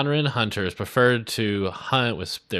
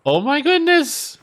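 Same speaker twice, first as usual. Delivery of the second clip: astonished cry; 7 dB louder, slower than usual. Real, not acted. Astonished at flying.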